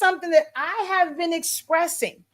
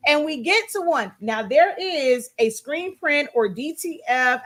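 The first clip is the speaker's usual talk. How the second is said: normal talking voice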